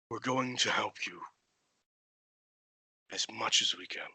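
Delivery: quiet reassurance. Acted. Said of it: "kind of chuckles and says".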